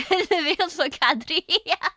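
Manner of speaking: can barely finish the line for giggling; laughing through words. Real, not acted. Streamer cracking up at her own Dutch joke, laughing.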